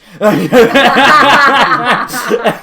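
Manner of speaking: loud high burst of laughter; big laugh. Real, not acted. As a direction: crack up out loud.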